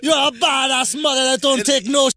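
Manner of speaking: mock-heroic pep talk in a clumsy Jamaican accent; bad Jamaican accent. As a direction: put on a terrible, proud Jamaican accent. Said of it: "worst Jamaican accent I ever heard".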